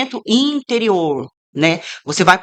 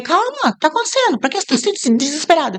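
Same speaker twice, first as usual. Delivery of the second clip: much higher-pitched urgent questioning; high, incredulous pleading; animated retold reassurance; 9 semitones higher than usual. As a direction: act out calming a frantic friend. Retold reply to sobbing friend.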